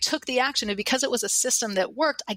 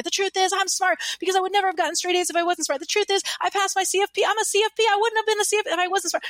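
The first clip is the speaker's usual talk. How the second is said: excited affirmation; 10 semitones higher, faster than usual. Retold or imitated. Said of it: "everyone screaming".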